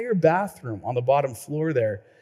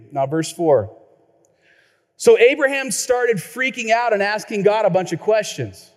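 normal talking voice

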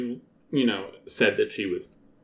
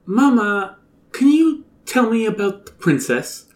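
put-on higher child voice; 8 semitones higher, 4 dB louder than usual. Toadette voiced asking mama.

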